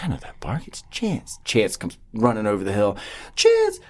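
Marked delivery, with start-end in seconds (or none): 0.0-1.4 breathless whisper, barely believing; voiceless amazed whisper; hushed whisper. Retold or imitated. Father hears the dog's bark. 3.4-3.8 put-on very high, squealing child calling his dog; a kid screaming with joy at his dog; high-pitched child's delighted cry; overjoyed kid. Kid sees his dog return.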